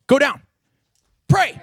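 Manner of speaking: loud, high shout of pray; yelled command. Acted. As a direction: bark it out like a drill coach. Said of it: "yell at the top of your lungs".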